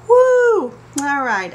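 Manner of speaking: high, loud whoop; amazed whoop. Real, not acted. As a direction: let out a big astonished woo. Reacting to son's cheek.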